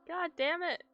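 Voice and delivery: ordinary delivery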